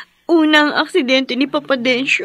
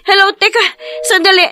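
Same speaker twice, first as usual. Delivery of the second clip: loud, higher, shouting into the phone; urgent calling out; 7 semitones higher, 6 dB louder, faster than usual. Acted. Wife shouting hello into the phone after the caller hangs up, urgent. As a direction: call after the caller who hung up.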